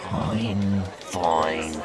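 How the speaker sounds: detective giving in to the captain, resigned; defeated sigh. Acted. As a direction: sigh and give up. Detective gives in reluctantly.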